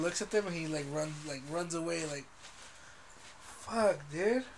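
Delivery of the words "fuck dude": normal talking voice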